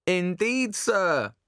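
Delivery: high, mock-formal butler tone; bow with exaggerated courtesy; mock servile. Lloyd mocks in Shakespearean speech.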